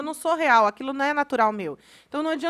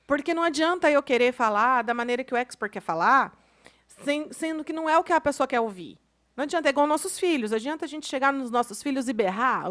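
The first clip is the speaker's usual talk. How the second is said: ordinary delivery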